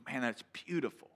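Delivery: softly voiced admiration; hushed awe. Taking a picture of the mountain view, hushed awe. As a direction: marvel at it, keeping it soft. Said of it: "sit there in awe and go".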